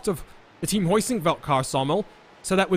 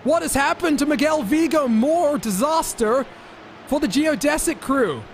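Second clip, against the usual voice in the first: excited commentary; 6 semitones higher than usual. Real, not acted. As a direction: raise the voice at a crash. Crash during live race commentary.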